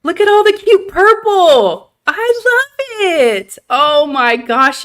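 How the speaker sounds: high, drawn-out excited squeal; delighted gushing. Real, not acted. Host seeing all the cute purple in the kit, gushing with delight. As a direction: squeal with delight, stretching the words out. Delighted by the artwork just unpacked.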